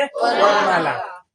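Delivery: slow, stretched answer word; long classroom prompt; drawn-out answer. Shown as a demonstration. Teacher drawing out the answer.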